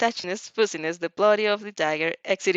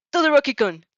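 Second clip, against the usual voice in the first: loud high warning call of the name; shout; 6 semitones higher, 7 dB louder than usual. A narrator's reading. Ida shouting a warning to Todoroki, loud. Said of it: "Ida shouts".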